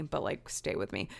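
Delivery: soft whisper to the viewers; whispered aside. Real, not acted. Vlogger whispering an aside to her viewers, hushed. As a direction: let them in on it in a whisper.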